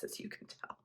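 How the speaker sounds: a quick whispered aside. Real, not acted. Whispering aside to viewers about dad's office.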